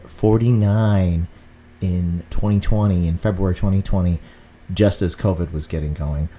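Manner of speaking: ordinary delivery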